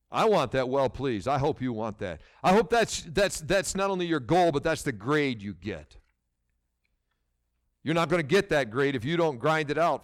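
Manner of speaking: usual speaking voice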